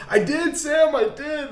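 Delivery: high-pitched mock protest, half laughing; retold protest. Actor looks at the camera after blowing his line, high mock protest. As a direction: protest loudly to the camera, hammed-up.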